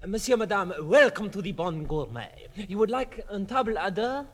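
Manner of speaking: put-on lightly done French waiter accent. Waiter welcoming the couple in a light French accent. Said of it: "that ridiculous regional accent".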